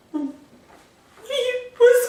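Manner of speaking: very high, drawn-out disbelieving cry; stunned Liv. Retold or imitated. Liv shocked to find Quinn alive, drawn-out high gasp of disbelief. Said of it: "So Liv is just like".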